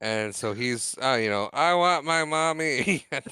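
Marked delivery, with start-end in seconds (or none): 1.5-3.1 slow, higher, drawn-out plea; mock whining child. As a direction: whine it like a little kid, in jest. Voices rider wanting out.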